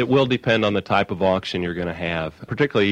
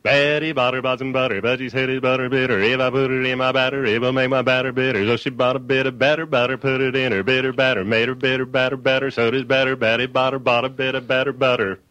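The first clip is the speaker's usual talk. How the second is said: quick auctioneer rattle through the twister; fast rhythmic; 3 semitones higher than usual. Shown as a demonstration. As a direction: rattle it off fast in a steady beat. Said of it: "Here's what it sounds like pretty fast".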